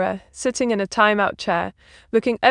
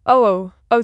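ordinary delivery

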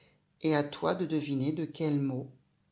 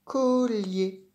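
"Collier" revealed louder and higher; word revealed; 6 semitones higher, 6 dB louder than usual. Shown as a demonstration. Teacher revealing "Collier" to the child, bright and loud. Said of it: "Ça fait quel mot?".

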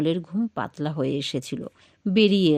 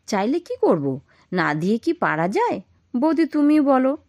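getting angry; 6 semitones higher, 4 dB louder than usual. A narrator's reading. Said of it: "Lalmohan রেগে উঠবে বলে".

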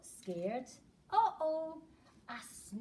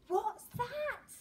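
startled gasp, then a higher question; mock fright; 5 semitones higher than usual. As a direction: play at being scared for the kids. Something in the cave.

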